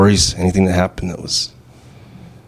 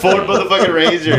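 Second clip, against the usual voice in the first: laughing mockery; 10 semitones higher than usual. Real, not acted. Mocking the truck.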